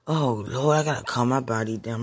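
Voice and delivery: ordinary talk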